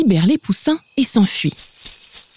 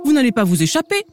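mild storybook yell; higher, hungry wolf's acted cry; 7 semitones higher than usual. A narrator's reading. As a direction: raise the pitch without real volume. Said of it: "Il hurle".

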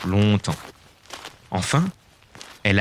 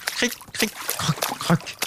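nibbling sounds; 12 semitones higher, 7 dB quieter than usual. Acted. Fish nibbling the giant.

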